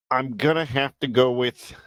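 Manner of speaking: ordinary talk, nothing marked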